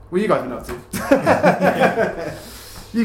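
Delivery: high, slow question through a laugh. Real, not acted. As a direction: ask it while laughing. Host laughs, turns to co-hosts.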